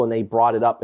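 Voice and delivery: normal talking voice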